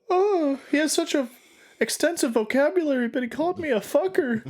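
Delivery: very high put-on affronted voice; high, hammed-up indignation; mock-offended. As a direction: act comically scandalized, high in pitch. Mock-offended reply to being sworn at.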